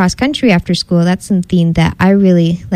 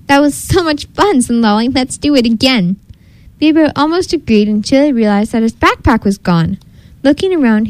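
lively storybook reading, higher than usual talk; story narration; 4 semitones higher than usual. A narrator's reading. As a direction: read a picture book to children with lifted, swooping pitch.

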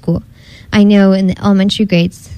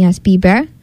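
lively storybook reading, higher than usual talk; story narration; 4 semitones higher than usual. A narrator's reading. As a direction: read a picture book to children with lifted, swooping pitch.

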